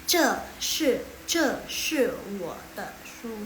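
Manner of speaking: "Ce shi wo de shu" each syllable set apart with pauses; separated syllables. Shown as a demonstration. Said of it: "ini semuanya dipisah". ("Ce shi wo de shu" dipisah per kata)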